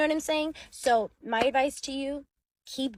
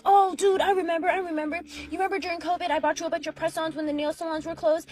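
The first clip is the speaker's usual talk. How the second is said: sudden excuse-making recall; 6 semitones higher, 4 dB louder than usual. Retold or imitated. Husband acted making sudden excuse.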